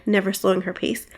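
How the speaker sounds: ordinary delivery, nothing marked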